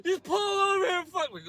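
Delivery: high, slow, drawn-out crying scream; hammed-up tearful screaming from the back seat; mock screaming. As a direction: wail the order like a hysterical girl. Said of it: "she starts screaming at me".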